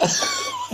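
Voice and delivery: sudden high burst of laughter. Real, not acted. Host laughing at the mother's line.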